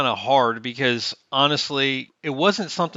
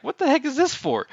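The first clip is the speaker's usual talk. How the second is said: high, incredulous squeak of disbelief; baffled question; 14 semitones higher than usual. Retold or imitated. Retelling his puzzled reaction to a friend's gift card, high and incredulous. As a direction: act thrown by a surprise gift. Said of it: "I'm like".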